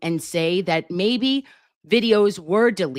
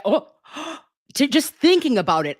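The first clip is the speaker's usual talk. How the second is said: gasping excitement; 8 semitones higher, faster than usual. Real, not acted. Gasps at thought of framing.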